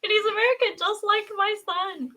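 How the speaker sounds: high, giddy exclaiming with laughs; excited and laughing. Real, not acted. Parent gushes to co-host about their son, excited and laughing.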